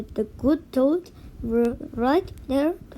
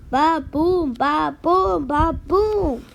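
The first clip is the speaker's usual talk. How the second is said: pounding heartbeat; 3 semitones higher, 4 dB louder, slower than usual. A narrator's reading.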